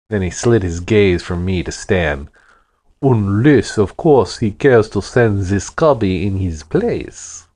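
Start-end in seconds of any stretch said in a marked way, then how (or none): 3.0-7.5 put-on booming Russian accent. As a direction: boom it out in a thick Russian accent. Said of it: "his voice boomed like a backfiring car with a Russian accent".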